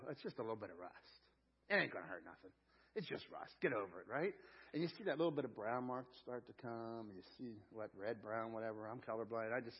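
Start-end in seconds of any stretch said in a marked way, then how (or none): 0.1-4.3 dismissive. Retold or imitated. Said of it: "this is what we all do".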